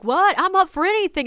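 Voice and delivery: high, loud, over-eager flirt; flirty stranger. Retold or imitated. Man coming on to a tour guide, flirty. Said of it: "he's trying to flirt with me".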